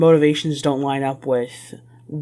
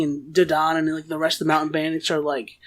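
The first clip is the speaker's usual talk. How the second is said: usual speaking voice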